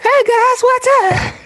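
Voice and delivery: very high, loud squeaky falsetto; mock creep voice. Shown as a demonstration. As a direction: pipe it up in a silly falsetto. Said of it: "I would talk more like".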